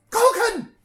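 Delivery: sudden high, full-force bellow of a name; loud shout. Acted. Commander leans in to wake a sleeping subordinate, loud shout. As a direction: shout to jolt a dozing soldier awake. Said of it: "leans in and loudly goes".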